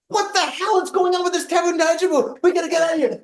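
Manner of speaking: very high-pitched frantic ranting; exasperated outburst. Retold or imitated. Husband urging wife to flee the tsunami, exasperated. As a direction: explode in hammed-up panicked frustration. Retelling outburst at family's vague reassurance.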